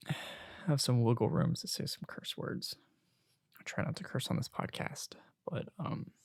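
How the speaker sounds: breathy confiding whisper, barely voiced; hushed, sheepish whisper; whispered aside. Real, not acted. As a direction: lean in and whisper the confession about cursing. Aside about cursing on podcast.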